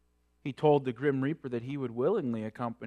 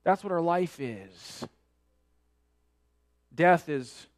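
normal talking voice

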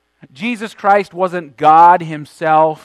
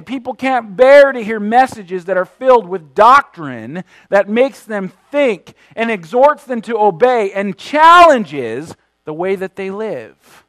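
usual speaking voice

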